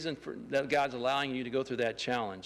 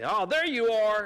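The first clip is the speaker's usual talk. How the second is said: brighter and louder, teasing the calf; mock surprised call; 5 semitones higher, 5 dB louder than usual. To the hiding calf, a playful mock surprised call. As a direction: sing out like you've just found it. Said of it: "and I'd say".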